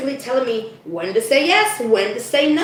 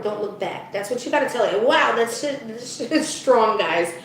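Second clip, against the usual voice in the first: usual speaking voice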